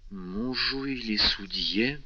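slow, faltering question; пролепетала. A narrator's reading. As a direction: stammer it out, rattled. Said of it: "пролепетала госпожа де Вильфор". (робко и сбивчиво пролепетать)

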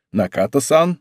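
a touch of coolness in the greeting; understated, restrained formal address; slightly cooled. A narrator's reading. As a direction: greet them politely but a little coolly. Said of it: "его голос слегка охладился".

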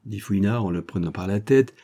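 usual speaking voice

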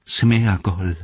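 Gollum's voice cleared, no croak; suddenly clear voice. A narrator's reading. Gollum suddenly looking Frodo in the face, clear voice. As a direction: say the name clearly and deliberately. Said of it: "plötzlich mit klarer Stimme".

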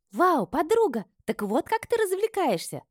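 very high, gleeful exclamation; thrilled girlfriend. A narrator's reading. Friend finds her amid naked men, thrilled. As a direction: squeal with delighted amazement.

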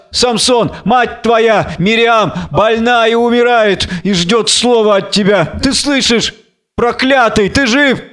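high, urgent, impassioned pleading; agitated, rising demands; pleading blind woman. Acted. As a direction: call down desperately for an answer.

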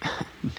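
leises, tonloses Kichern; unterdrücktes Lachen. Acted. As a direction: lach nur hauchend in dich hinein. Lawyer stifles laugh, apologizes. (tonloses Lachen, als die Anwältin sich über den Häftling amüsiert)

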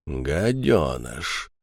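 low, soft, very drawn-out mutter; impassive, stretched word with a lifted ending; emotionless. A narrator's reading. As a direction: repeat it slowly with no feeling. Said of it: "безэмоционально констатировал Монокль".

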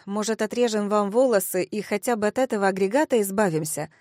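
ordinary delivery, nothing marked

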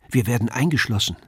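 plain reading voice

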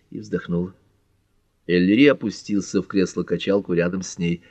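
plain reading voice

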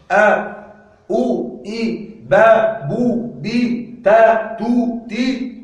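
each syllable sounded out slowly and apart; slow syllable drill. Shown as a demonstration. Teacher sounding out vowel syllables for students, slow and spaced. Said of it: "teacher sounds out vowel syllables".

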